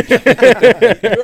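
big burst of loud laughter. Real, not acted. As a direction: explode into laughing.

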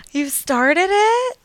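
high, slow, delighted disbelief; surprised. Real, not acted. Hearing her friend restarted the show, delighted surprise. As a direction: draw it out in happy shock.

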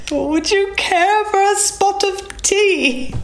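very high, drawn-out mock-British; British accent. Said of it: "how to say in a British accent".

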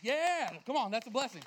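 loud, jubilant preacher's cheer; rally the crowd with a loud cheer; celebrating. Real, not acted. Preacher celebrating the blessing with the congregation, jubilant shout.

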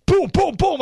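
high-pitched mock "boom, boom, boom"; imitated gunshots. Host mocking the headline's version of the shooting, imitated gunshots. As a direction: fire off the booms high, like cartoon shots.

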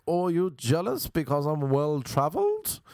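theatrical English-sounding man. Retold or imitated. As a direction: imitate an affected, theatrical show-off. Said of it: "this guy actually speaks like this".

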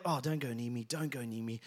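low, hushed mutter of disgust; disgusted aversion. Retold or imitated. Man avoiding beggars in the street, disgusted. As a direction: recoil quietly, keep them away. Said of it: "his face was one of disgust".

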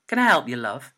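loud, polite shop greeting. A narrator's reading. Shopkeeper offering help to an undecided customer, loudly polite. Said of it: "she asked politely".

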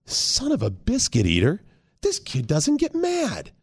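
slow, quiet disbelief rising high; stunned realization. Acted. Dad realizing his kid never gets mad, slow disbelief. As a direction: let it dawn on you, drawn out.

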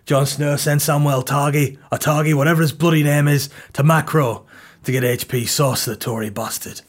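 put-on accent, deadpan fantasy character; Game of Thrones voice. Character explains Jon Snow's errand for sauce, mock Game of Thrones voice. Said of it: "And he'll be like".

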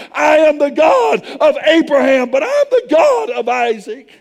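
rising, shouted revival preaching; full-force preaching. Acted. Preacher rallies the congregation, shouting the old promise. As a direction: build it to a shout for the crowd.

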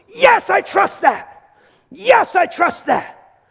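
a created man eagerly answering God's voice. Acted. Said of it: "You've been created to go".

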